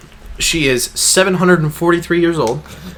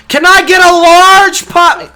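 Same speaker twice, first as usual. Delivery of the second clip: high, loud, slow mock shout of the order; loud mock repeat; 10 dB louder, slower than usual. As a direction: repeat the order loudly and slowly. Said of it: "I can't hear you".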